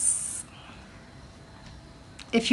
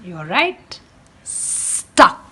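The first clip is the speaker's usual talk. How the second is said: lively storybook read-aloud for a class; animated picture-book reading; reading to children; 5 dB louder, slower than usual. Acted. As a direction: read it like storytime for little ones. Teacher reads picture book aloud.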